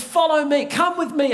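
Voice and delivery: put-on high, eager child's "Quick"; a small boy hurrying the soldier along; urgent boy. Boy urges soldier to hurry, high childlike voice. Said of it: "and says".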